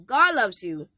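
ordinary delivery

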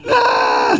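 high, mock toddler wail. Imitating daughter wailing at her parents. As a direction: cry out like an upset toddler. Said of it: "She just kept on doing that".

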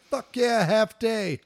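put-on loud, high kid cheer; excited kid. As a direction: cheer it like a kid getting a half day. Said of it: "I'd be like".

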